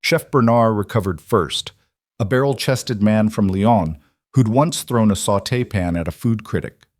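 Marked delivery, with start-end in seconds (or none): none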